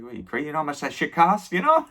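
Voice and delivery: high, incredulous scoff; mock the kid's question, squeaking up high; scoffing mortician.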